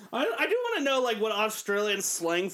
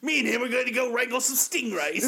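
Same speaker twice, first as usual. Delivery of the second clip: mock Australian junkie; 5 semitones higher than usual. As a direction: ham up the junkie's big plans. Mock Aussie junkie bragging.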